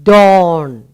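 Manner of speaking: clear, drawn-out "dawn", open aw; modelled word. Shown as a demonstration. Teacher to class, clearly modelling "dawn". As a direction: stretch the aw in "dawn". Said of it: "that part of the day is called".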